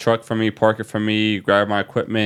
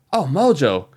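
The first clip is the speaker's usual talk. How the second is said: pumped-up higher imitation of a hyped host; excited Pat McAfee; 6 semitones higher than usual. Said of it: "he turns around and goes".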